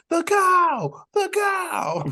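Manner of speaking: high, drawn-out mock scream; imitated crowd scream. Retelling a rap show to co-hosts, imitated screaming. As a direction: holler it like fans at a show. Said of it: "people were screaming".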